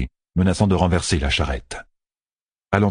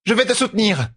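high, urgent command; urgent call. A narrator's reading. Fail urging the wounded Berisha on, calling out. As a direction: urge her on with a raised cry. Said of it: "lança Fail à Berisha".

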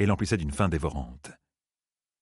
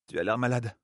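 higher-pitched, lightly worried woman's voice; concerned companion; 4 semitones higher than usual. A narrator's reading. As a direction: voice mild concern for him. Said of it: "demanda Min". (inquiétude légère, voix plus aiguë)

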